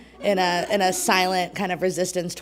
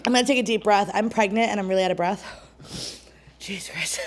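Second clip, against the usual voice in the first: ordinary talk, nothing marked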